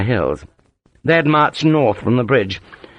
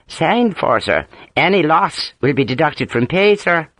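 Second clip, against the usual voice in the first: put-on Ulster accent; 6 semitones higher than usual. A narrator's reading. Harper answering in his accent.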